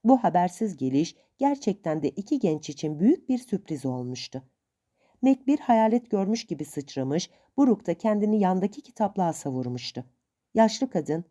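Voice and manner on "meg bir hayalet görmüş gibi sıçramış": ordinary delivery, nothing marked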